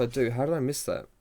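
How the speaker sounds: ordinary delivery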